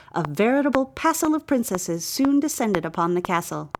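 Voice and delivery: plain reading voice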